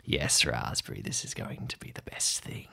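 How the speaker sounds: low creepy whisper to a machine. Acted. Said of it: "talking to it".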